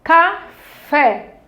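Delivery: "Café" split ca... FÉ, both clear; teacher's syllable-by-syllable "Café"; separated syllables. Shown as a demonstration. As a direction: say "Café" slowly in two detached beats. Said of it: "a senhora tá falando separando".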